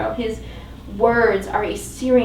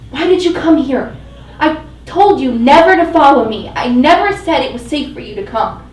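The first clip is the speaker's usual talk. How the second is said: raised, louder reproach; angry scolding; 4 semitones higher, 8 dB louder than usual. Acted. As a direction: scold her for following him. He scolds her in quarrel.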